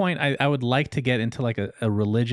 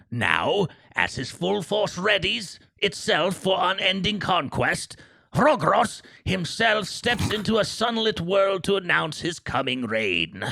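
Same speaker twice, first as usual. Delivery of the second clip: put-on high, slow, strained old storyteller voice; Old Man Gillywinkle; 5 semitones higher than usual. Reading game lore to his co-host as a high, slow old man. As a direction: creak it out like a grand old lore-keeper, much higher than your own. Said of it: "Old Man Gillywinkle?".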